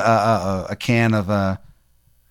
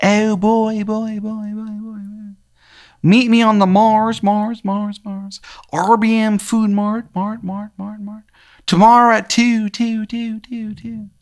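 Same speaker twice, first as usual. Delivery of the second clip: high call with each repeat fading away; disembodied high voice, echoing off into nothing; echoing voice in the head; 11 semitones higher, slower than usual. Acted. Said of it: "you hear this voice in your head".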